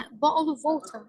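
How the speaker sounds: British "bottle of water" with non-rhotic ending; British accent. Shown as a demonstration. Teacher demonstrating accents for students, British accent. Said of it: "إذا بدنا نروح على البريتش".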